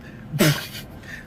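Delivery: unvoiced, breathy laugh; laughing at the dad's crazy questions. Real, not acted. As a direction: let out a silent, breathy laugh.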